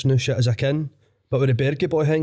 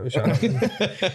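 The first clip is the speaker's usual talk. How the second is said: slow, higher Sausage King drawl with a laugh; 5 semitones higher, slower than usual. Pretend. Dealer greeting a punter in the Sausage King voice. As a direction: stretch out "happening" in a dodgy dealer voice. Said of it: "Cutting about like that".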